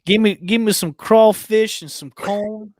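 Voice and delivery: hammed-up New Orleans accent; bad New Orleans accent. Host craving Mardi Gras food, bad New Orleans accent. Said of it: "my worst New Orleans accent".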